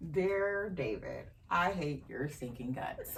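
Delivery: slow, mock-solemn letter reading; mock hate letter. Joking to David on the podcast, mock-solemn slow reading. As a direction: read it like a grand, insulting letter, slowly.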